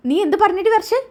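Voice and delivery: mother-in-law stunned by Varsha's retort; shocked mother-in-law. Acted. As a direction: gasp out the question in shock.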